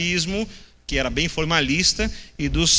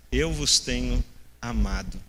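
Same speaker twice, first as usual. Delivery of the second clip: tender; 6 semitones lower than usual. Shown as a demonstration. Preacher reading God's words of love to the church, tender. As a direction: let God's love sink in gently. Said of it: "esse é um Deus que ama mesmo".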